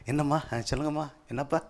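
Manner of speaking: hushed, affectionate coaxing; softly spoken, tender demonstration; loving. Shown as a demonstration. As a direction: model a gentle, loving reply to one's children. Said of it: "பேசி அன்பா சொல்லுங்க".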